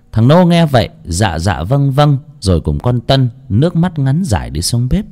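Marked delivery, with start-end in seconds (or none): none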